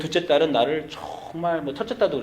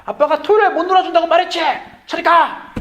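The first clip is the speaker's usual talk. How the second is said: much higher, louder, fast exasperated yell; yelling at son; 8 dB louder than usual. Retold or imitated. Fed-up dad yelling at his seven-year-old to go away. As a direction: snap and yell at the pestering kid. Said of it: "결국 소리를 이제 지릅니다".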